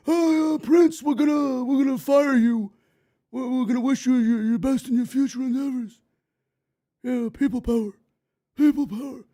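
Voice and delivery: loud, brash put-on voice of a company firing call; mock WWE office caller. WWE office phoning a wrestler to fire him, loud mock exec voice. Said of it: "that two oh one area code shows up".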